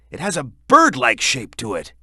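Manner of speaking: normal talking voice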